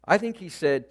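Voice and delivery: ordinary talk, nothing marked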